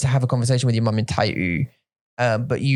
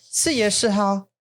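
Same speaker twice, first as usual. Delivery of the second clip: put-on Taiwanese accent; 6 semitones higher, slower than usual. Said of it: "Stop being an idiot".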